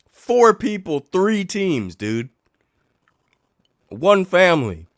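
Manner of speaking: high, loud disbelief; incredulous. Real, not acted. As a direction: hammer home the absurdity. Incredulous about mixed fandom.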